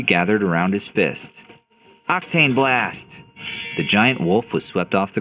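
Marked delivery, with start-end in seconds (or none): 2.1-3.1 higher-pitched battle move call; attack call. Acted. Hero strikes the giant wolf, calling out his attack. Attack name called in a fight.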